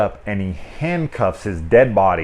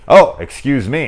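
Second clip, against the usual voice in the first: high, loud mock-polite; imitating a polite intruder, louder and higher; polite interruption; 6 semitones higher, 9 dB louder than usual. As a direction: chirp it like a caught-off-guard guy. Voicing the guy walking in.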